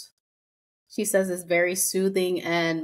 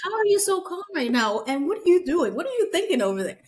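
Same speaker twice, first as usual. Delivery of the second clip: higher, rushed baffled questions; anxious disbelief; 8 semitones higher, faster than usual. Retold or imitated. Podcaster baffled by calm meditators, rushed. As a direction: fire questions fast and high. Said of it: "It's like".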